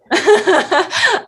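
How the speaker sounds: short bright giggle; kind gentle laugh. Real, not acted. Laughs after "Yeah, I am.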